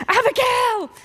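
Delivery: high, loud calling out a name; urgent call. Retold or imitated. Wife calling from another room, loud urgent call.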